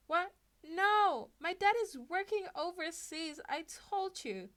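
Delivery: high, loud flustered protest; flustered denial. Acted. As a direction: blurt it out, caught off guard, then slow down. Izuku denies flustered.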